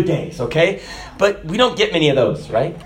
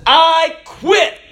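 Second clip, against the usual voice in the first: high, loud I quit; exasperated outburst; 10 semitones higher, 4 dB louder than usual. Retold or imitated. Monk quitting in front of the elders, exasperated shout. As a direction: throw up your arms and quit. Monk finally quits, arms thrown.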